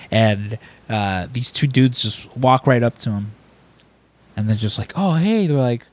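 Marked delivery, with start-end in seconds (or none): 4.9-5.5 higher-pitched mock eager stranger; eager greeting. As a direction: imitate a fan approaching someone. Strangers greeting Frank in retold scene.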